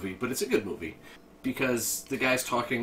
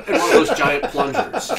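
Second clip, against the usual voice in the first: laughing; 12 dB louder than usual. Real, not acted. Laughing while retelling movie scene.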